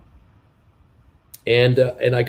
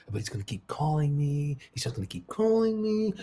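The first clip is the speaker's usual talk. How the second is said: quoting Ferris Bueller; 11 dB quieter than usual. Retold or imitated. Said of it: "I'm quoting Ferris Bueller's Day Off".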